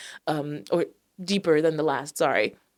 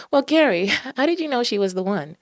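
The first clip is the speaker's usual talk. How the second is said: high, loud gushing host; put-on host voice interviewing Gary; interviewer voice; 6 semitones higher, 5 dB louder than usual. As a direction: ask it like a bubbly talk-show host. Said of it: "I don't know why I'm doing that voice".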